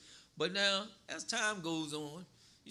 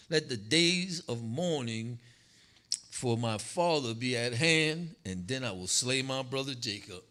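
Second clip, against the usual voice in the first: slow, louder, every word weighed; emphatic re-read; 4 semitones lower, 6 dB louder than usual. Shown as a demonstration. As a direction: lean on each word of the verse. Re-reads verse for emphasis.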